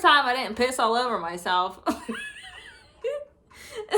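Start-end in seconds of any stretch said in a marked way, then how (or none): 1.9-2.6 high squealing laugh bursting out; burst of laughter. Real, not acted. Telling the hospital story, she shrieks with laughter. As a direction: laugh high and hard at the memory.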